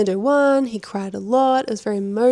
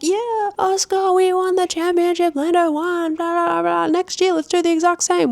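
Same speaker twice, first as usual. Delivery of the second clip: mocking dismissive boss; 9 semitones higher, 3 dB louder than usual. Mocking Zak Brown's brush-off to Oscar, high and dismissive. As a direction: sneer through it like a smug team boss. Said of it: "He basically was like".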